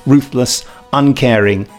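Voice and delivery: normal talking voice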